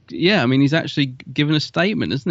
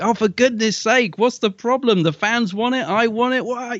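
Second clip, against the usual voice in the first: pitched up, exasperated outburst; exasperated John Barrowman; 9 semitones higher than usual. Retold or imitated. Host mimics Barrowman snapping at the blockers, pitched-up exasperation. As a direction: vent like a fed-up star who can't believe the holdup. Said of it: "He's sort of like".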